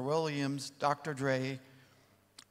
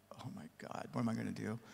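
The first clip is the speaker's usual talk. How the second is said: breathless whisper of panic to himself; hushed, frantic inner thought; panicked whisper; 4 semitones lower, 8 dB quieter than usual. Retold or imitated. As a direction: whisper it like you're terrified of being heard.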